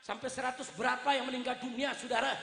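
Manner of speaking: normal talking voice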